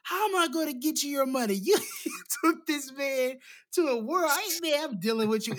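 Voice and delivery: Ginger's voice pitched high and pleading; a lightly voiced Ginger begging; high mock Ginger. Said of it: "She was like".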